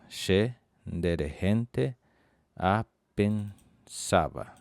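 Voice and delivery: "Che nderehente apensava" drawn out very slowly; very slow model. Shown as a demonstration. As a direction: spell out each word for learners.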